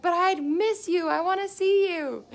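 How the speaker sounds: put-on high, loud, pleading girlfriend voice, drawn out; whiny girlfriend. Girlfriend whining to her tired partner, in a high pleading voice. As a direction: whine like a clingy partner missing him. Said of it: "And she's like".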